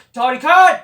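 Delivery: sudden loud bellowed name; yelling at his son in mock fury; angry father yelling. A father furious at his son, yelling his name loudly. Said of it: "Tony Khan's father's gonna be fucking angry".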